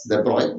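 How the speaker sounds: de Broglie said as "duh BROY"; de Broglie ending in an "oy" sound; original French pronunciation. Shown as a demonstration. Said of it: "so you pronounce as".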